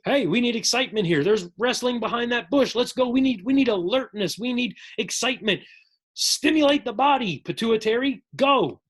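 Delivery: rally the troops, there's danger near; urgent boss. Acted.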